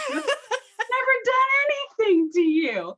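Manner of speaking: high loud laughing wail of protest; mock wail. Real, not acted. Medium protesting the elf's suspicion, high laughing wail. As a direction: cry out high in laughing indignation. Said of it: "I'm like the nicest new medium".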